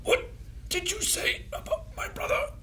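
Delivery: whispery, squeaky put-on voice; hushed falsetto impression; imitated character voice. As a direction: imitate him in a thin, high whisper. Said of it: "Just the voice is like".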